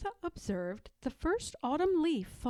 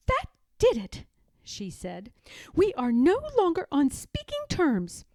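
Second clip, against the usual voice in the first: much higher, louder indignant declaration; indignant Martha; 5 semitones higher, 6 dB louder than usual. Acted. Martha cuts off George after the prank, higher and indignant. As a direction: announce it, offended. Martha angrily ending friendship.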